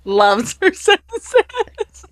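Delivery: laughing while quoting her friend; laughing mock quote. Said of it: "And I'm like".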